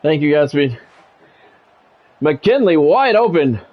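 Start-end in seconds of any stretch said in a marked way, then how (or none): none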